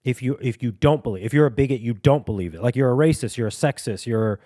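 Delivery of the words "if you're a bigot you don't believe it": usual speaking voice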